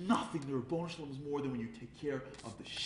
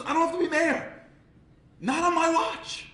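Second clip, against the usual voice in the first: loud, high-pitched defiance; defiant shout; 8 dB louder than usual. Retold or imitated. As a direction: shout it down, stretching the last words. Moshe stands up to shepherds.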